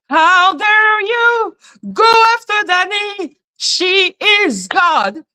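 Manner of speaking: mock outraged cry; mock outrage. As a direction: overplay the shock.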